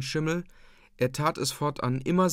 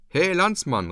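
higher, louder hail to a stranger; calling out; 6 semitones higher, 5 dB louder than usual. A narrator's reading. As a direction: call out to a passer-by. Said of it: "rief ich". (rufend, laut und hoch)

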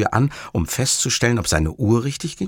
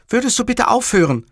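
high, exasperated call; 13 semitones higher, 4 dB louder than usual. A narrator's reading. Livia calls to Montalbano to stop swearing, high and exasperated. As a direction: protest in a high-pitched woman's voice. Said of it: "rief Livia".

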